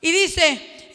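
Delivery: ordinary talk, nothing marked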